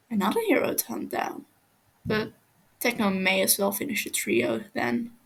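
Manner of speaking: plain reading voice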